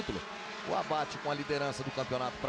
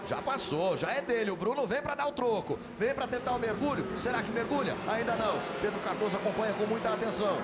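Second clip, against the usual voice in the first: raised, urgent race call; excited play-by-play; 8 semitones higher than usual. Real, not acted. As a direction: narrate the attack as it happens. Live call of attempted pass.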